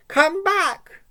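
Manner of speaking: high loud yell after the cat. Acted. Mouse chasing the cat yells after him, high and loud. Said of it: "yelled Tishy".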